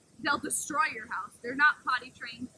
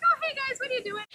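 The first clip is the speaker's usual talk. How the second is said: mock cheery greeting; 11 semitones higher than usual. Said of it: "You're like".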